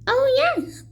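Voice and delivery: high, chirpy little mayor; the tiny mayor answering Horton; piping tiny voice. A narrator's reading. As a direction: pipe it up eagerly. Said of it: "piped the voice".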